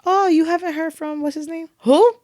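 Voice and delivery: parents asking about an old boyfriend, mock puzzled; imitating parents. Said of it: "I'm like".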